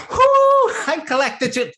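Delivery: very high, loud shriek of delight; gleeful whoo. Retold or imitated. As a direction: squeal with joy over the money. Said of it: "she's like".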